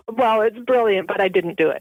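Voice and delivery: ordinary talk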